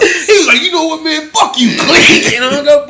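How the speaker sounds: high, loud laughing story; half-laughing as he shouts it out; laughing loud retelling. Real, not acted. Cracking up while telling friends how he got hung up on.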